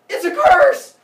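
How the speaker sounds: sudden high, booming yell; spring the trap at full volume; hammed-up explosive reveal; triumphant mock shout. Uranus springs the curse.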